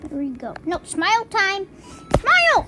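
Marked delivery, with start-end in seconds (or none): 2.2-2.7 high, loud excited shout. Real, not acted. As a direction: yell it out high and bright. Urging in-game character to smile.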